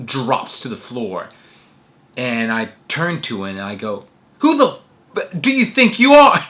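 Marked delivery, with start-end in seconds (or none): 4.4-6.5 much higher, louder pretend fury; mock outraged shout. Joking fake comeback to friend, hammed-up yelling. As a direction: fake an indignant blow-up as a joke.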